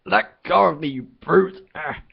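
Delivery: high, loud angry shout; struggling shout. Acted. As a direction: yell while wrestling free. Arthur struggling against guard.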